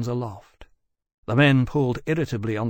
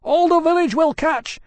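high, loud, gasping plea; frantic begging; 6 dB louder than usual. A narrator's reading. Villager begging the dragons to put out the fire, panicked. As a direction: beg in panic as the roof burns. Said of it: "begged, gasping".

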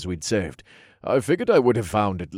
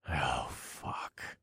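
quiet; 6 semitones lower, 14 dB quieter than usual. Acted. Narrator sees the cracked egg.

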